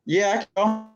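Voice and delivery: choppy, freezing connection; glitching audio breaking up. Real, not acted. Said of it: "It's glitching".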